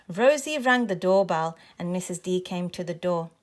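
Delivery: plain reading voice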